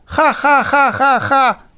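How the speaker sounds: high, teasing ha-ha; mocking laugh. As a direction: laugh at him like the kids. Crowd laughs at Diego.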